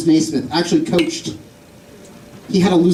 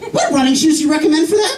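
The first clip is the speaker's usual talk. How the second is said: higher-pitched customer asking in earnest; mock customer question; 8 semitones higher than usual. Customer asks the shoe seller for advice, eager and high.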